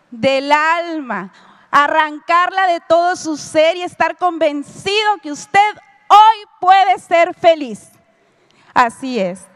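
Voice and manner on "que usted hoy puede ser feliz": emphatic declaration. Acted. Preaching climax on happiness.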